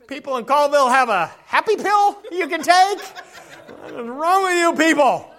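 mock disbelief through laughter; laughing exasperation. Real, not acted. Preacher laughing at grumpy townspeople, exasperated.

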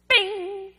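high, loud "bing" of a bell; timer bell imitation. Timer goes off for bath time, sudden high bell imitation. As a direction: ring out like a timer going off. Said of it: "The bell went off".

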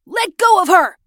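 high, loud command; fierce protective yell; angry shout. A narrator's reading. Brother defends his sister from Kenny, angry shout.

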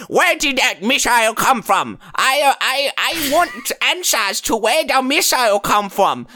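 high-pitched, hammed-up Korean accent; mock North Korean accent. North Korean interrogating about the missile, mock Korean accent. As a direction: demand answers in a put-on Korean accent. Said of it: "the North Koreans are like".

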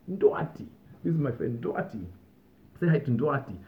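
"Ndwati" punched high, each time it comes; emphatic name. Retold or imitated. Kev introducing his friend to everyone, stressing "Ndwati" hard. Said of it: "he'd say with such emphasis".

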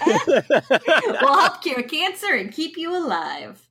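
slow, high put-on slogan delivery; mock slogan voice. Cohost pitching fake juice slogan, in slow high mock voice. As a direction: stretch it out like a silly jingle tagline.

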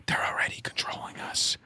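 conspiratorial hushed whisper. Real, not acted. Speaker tells conspiracy believers they are already controlled, whispering. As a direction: drop to a whisper, as if letting out a secret. Same words repeated as a secret.

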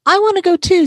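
put-on very high little-girl wail; eager child's plea. A narrator's reading. Little sister wanting to join the birthday dinner, eager plea. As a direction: plead high to be included.

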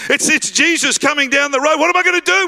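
high-pitched mock panic; flustered excitement. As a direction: play it stammering and worked up, rising to the question. Beggar panics as Jesus approaches.

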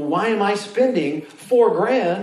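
high, slow indignant question; incredulous student. Retold or imitated. Student scoffing at the professor, slow and indignant. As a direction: ask in mock disbelief, drawn out. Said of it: "And I think".

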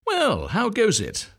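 put-on high, slow nosy old duck; old duck visitor. A narrator's reading. Old duck visiting the nest, slow and high. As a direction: drawl it like a prying old neighbour. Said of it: "said an old duck".

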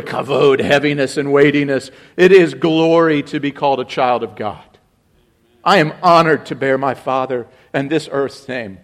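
ordinary talk, nothing marked

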